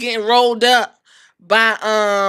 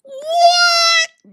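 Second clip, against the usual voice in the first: shocked yell. Retold or imitated. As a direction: scream it in shock at the TV. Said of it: "I said".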